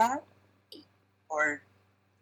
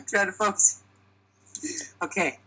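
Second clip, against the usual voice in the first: ordinary talk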